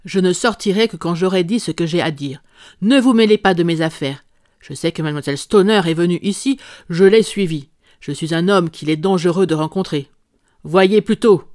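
loud, menacing warning; a brute warning the detective to keep out of his affairs; angry threat. Acted. Visitor threatens Holmes.

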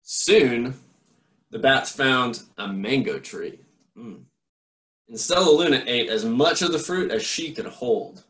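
put-on lively read-aloud voice for children; story narration. A narrator's reading. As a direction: read it like a bedtime picture book. Reads a picture book aloud.

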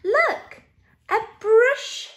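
high storybook exclamation; excited discovery. A narrator's reading. Narrator showing children a newcomer, excited. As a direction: point it out with surprise.

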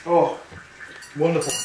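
ordinary talk, nothing marked

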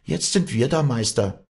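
high, lively call. A narrator's reading. Walli to the vulture on the woodshed, high lively call. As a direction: call it up proudly, pitched high. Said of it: "rief sie im Vorbeigehen dem Geier zu".

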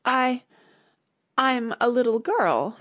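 high, halting, slow uncertainty; hesitate, then offer it doubtfully; rather doubtful. A narrator's reading. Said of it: "rather doubtfully".

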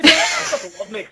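put-on high, loud, slow thick Scottish accent. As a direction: squeal it out in a thick Scottish brogue. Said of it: "I would have this very thick Scottish accent".

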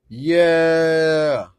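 loud, high, drawn-out yeah; excited cheer. Real, not acted. Card opener pulling an all-time favorite, excited cheer.

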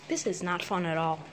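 normal talking voice

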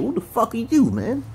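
high-pitched exasperated challenge; mock indignant outburst; acted street-talk scolding. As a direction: act out confronting him in street talk. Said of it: "I gotta talk like that and shit".

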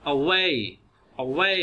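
"Away" with a short, light first A and stressed "way"; unstressed first syllable. Shown as a demonstration. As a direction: keep the A in "Away" brief, land on "way". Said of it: "उस पे stress नहीं देना, उसको लंबा नहीं करना". ("Away" में पहला अ हल्का, way पर ज़ोर)

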